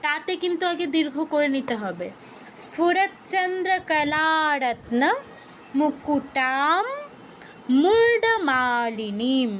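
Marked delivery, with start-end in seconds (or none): 2.6-9.5 slow syllable-by-syllable chant of স্ফুরতচন্দ্র কলারত্ন; slow recitation. Shown as a demonstration. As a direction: recite slowly for students. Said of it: "উচ্চারণটা কি হবে?".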